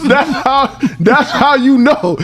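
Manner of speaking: high, stretched-out words through laughter; laughing hard. Real, not acted. Cracking up with a friend over the cop's reaction, laughing hard. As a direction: wheeze it out slowly, cracking up.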